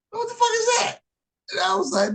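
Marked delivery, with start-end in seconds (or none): 0.1-1.0 high mock cry of disbelief. Friend seeing the racquetball racket, a high mock outcry. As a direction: squeal it out, baffled.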